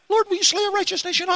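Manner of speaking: high, thin, fast frightened plea; scared to death. Acted. Abimelech pleading with the Lord, terrified, high and fast. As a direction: beg the Lord in terror. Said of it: "I believe he's scared to death".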